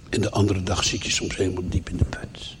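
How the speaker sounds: hushed, as if deep in the pit; dejected. Acted. Preacher describes a bad day to the congregation, dejected and hushed.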